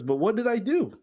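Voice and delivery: high pleading victim voice, voiced lightly. Streamer voicing the victim's text, high and pleading.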